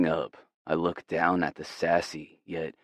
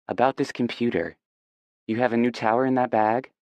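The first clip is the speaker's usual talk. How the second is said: brisk heroine voice; 6 semitones higher, faster than usual. Acted.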